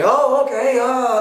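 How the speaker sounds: high, loud caricature of someone off-center; mock eager placating. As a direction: overdo an anxious-to-please reaction. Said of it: "they'll change everything".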